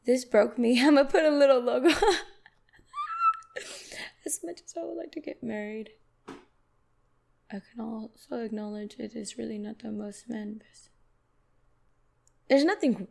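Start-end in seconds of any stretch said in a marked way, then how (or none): 4.2-10.9 hushed mocking reread. Shown as a demonstration. Rereads the tweet much softer.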